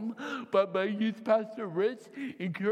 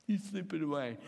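normal talking voice

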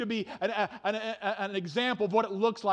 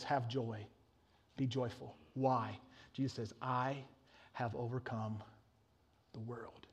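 hushed scripture; 10 semitones lower, 13 dB quieter, slower than usual. Acted. Scripture read hushed and soft.

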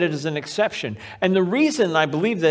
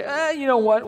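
higher-pitched, waving-it-off "eh"; mock reluctant, pitched up; mock dismissive; 9 semitones higher than usual. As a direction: imitate someone casually brushing people off. Voices people who exclude others.

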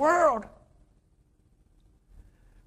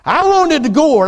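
higher, louder sulky complaint; mad Jonah; 7 semitones higher, 4 dB louder than usual. Retold or imitated. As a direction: whine it like a spoiled child. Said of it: "And he's mad".

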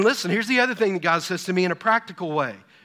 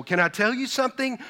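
ordinary talk, nothing marked

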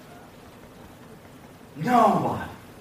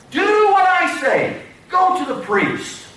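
high, slow proclaimed order; firm command; 7 semitones higher, slower than usual. Acted. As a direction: declare it as a commanding instruction. Preacher voices Jesus's command.

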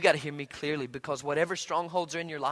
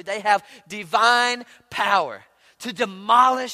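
forceful scripture read; 5 semitones higher, 11 dB louder, slower than usual. Acted.

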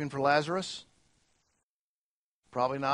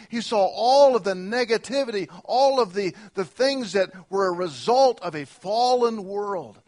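louder, higher preaching build; impassioned preaching; 5 semitones higher, 6 dB louder than usual. Real, not acted. Pastor pressing the congregation about a fallen world, preaching hard. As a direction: drive it home to the congregation.

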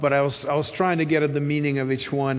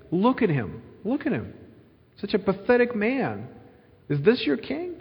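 higher-pitched, scornful belittling; mocking Pilate; 6 semitones higher than usual. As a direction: show him off as pathetic, ending in a rising question. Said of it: "a mocking statement".

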